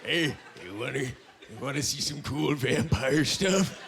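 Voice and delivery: low, slow, hesitant creep voice; creepy vampire lure. Acted. Old vampire tempting a schoolkid, creepy and furtive. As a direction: sidle up with a slow, low invitation.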